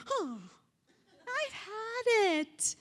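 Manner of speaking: high, drawn-out exasperated cry; fed-up outburst. Acted. Speaker mimics a parent's Kodak moment, fed up.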